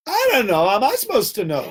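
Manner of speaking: high, laughing protest; a cabbie throwing up his hands, half laughing; exasperated driver. Retold or imitated. Taxi driver protesting he can't know the dog's mother, high and laughing.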